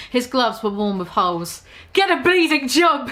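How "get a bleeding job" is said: a hammed-up posh yell, higher; Piers yelling. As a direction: bark it at the beggar with contempt. Piers yells at beggar.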